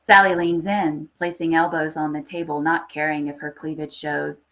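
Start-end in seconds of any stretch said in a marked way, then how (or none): none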